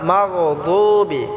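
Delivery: slow "মাগযুবি" with a thick emphatic d; full Arabic daad in "মাগযুবি"; heavy daad. Shown as a demonstration. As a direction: fill the mouth on the du of "মাগযুবি". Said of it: "ভালো করে উচ্চারণ করতে হবে মোটা করে".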